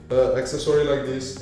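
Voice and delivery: usual speaking voice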